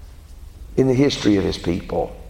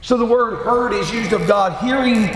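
preaching; 9 semitones higher than usual. Acted. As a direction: build the sermon, leaning on each phrase. Preacher at full force on scripture.

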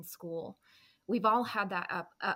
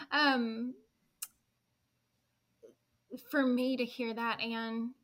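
steady voice with shaky moments; slower than usual. Real, not acted. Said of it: "I'm a little emotional about that".

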